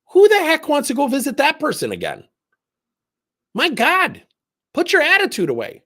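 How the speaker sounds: high, loud sarcastic outburst; mock exasperation. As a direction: voice the fed-up boss, loud and high. Mocking someone reacting with attitude.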